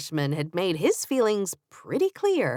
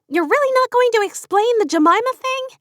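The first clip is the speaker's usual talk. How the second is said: incredulous demand; 7 dB louder than usual. Acted. Katie demanding an explanation.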